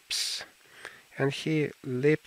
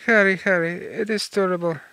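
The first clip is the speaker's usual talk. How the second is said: loud, high anguished cry of his name; 5 semitones higher, 6 dB louder than usual. Acted. Dorian, hearing how Sybil died, cries out in horror. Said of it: "cried Lilette".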